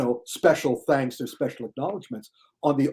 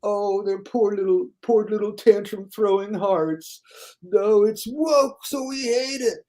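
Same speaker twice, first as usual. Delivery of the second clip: mock tantrum critic; 7 semitones higher, slower than usual. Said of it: "They're so".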